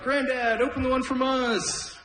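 a granddaughter urging her grandpa, high and eager. Retold or imitated. Voicing granddaughter in story.

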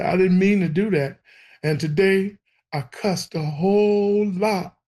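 put-on high, pleading child's apology; imitated crying confession. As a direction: act out a kid tearfully owning up. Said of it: "I'm crying and I'm telling him everything".

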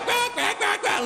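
very high, squawky quacking; hammed-up duck; duck quacks.

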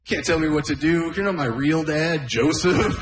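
high, sulky talk-back; a kid sassing his stepdad; bratty teen. Retold or imitated. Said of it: "I'd be like".

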